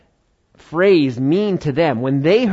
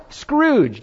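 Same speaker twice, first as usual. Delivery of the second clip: high-pitched, acted instant recognition; excited recognition; 12 semitones higher than usual. American who knows the character at once, acted excited recognition. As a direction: say it high, as a word you know well.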